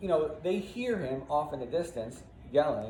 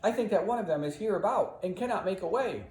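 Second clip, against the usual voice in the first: raised, declaiming Shakespeare line; dramatic line reading; 9 semitones higher than usual. Shown as a demonstration. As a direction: deliver the verse with drama. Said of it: "He says".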